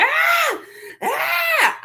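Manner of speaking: put-on high wailing child's screams, crying out; childish scream. A girl in the braiding chair screams and cries out. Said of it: "crying and screaming".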